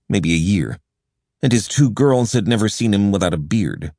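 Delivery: ordinary delivery, nothing marked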